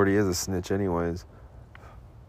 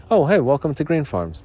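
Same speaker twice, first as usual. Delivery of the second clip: cheery greeting; 6 semitones higher, 4 dB louder than usual. Retold or imitated. Greeting customers at dispensary door.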